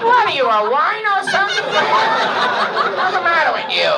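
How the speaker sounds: high, shouted bandleader outburst. Acted. Bandleader snaps at the spaced-out trumpeter, shouting. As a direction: bandleader loses his patience, high and loud.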